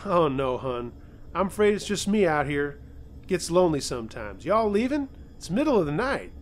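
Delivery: lightly voiced Southern old lady; a hint of a sweet Southern neighbour; easy Southern twang, chatty; southern drawl. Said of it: "in a southern drawl".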